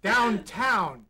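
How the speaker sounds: "Downtown" with both halves fully finished; "Downtown", fully enunciated and projected; enunciated ending. Shown as a demonstration. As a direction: say "Downtown" crisply to the final n. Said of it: "finish the ends of words".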